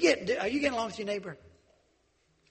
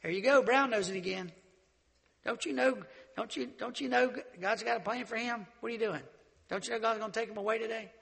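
higher, teasing jeer; mocking buddies; 5 semitones higher than usual. Buddies jeering at Elisha for following Elijah, teasing. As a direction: needle him like a jealous classmate. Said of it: "all of his buddies said".

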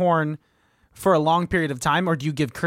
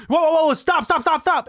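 much higher and louder, alarmed shouting; panicked yell; 11 semitones higher, 3 dB louder than usual. Retold or imitated. Dad teaching his kid to drive, panicked shouting. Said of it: "and he was like".

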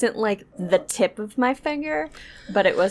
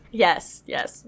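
ordinary talk, nothing marked

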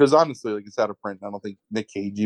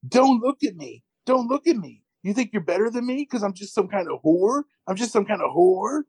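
high, wounded-indignant imitation; mock hysterical outburst; 9 semitones higher than usual. Host as the man lashing out at friend, mock hysterics. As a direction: imitate an actor melting down, pitched up.

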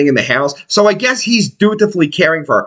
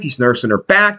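usual speaking voice

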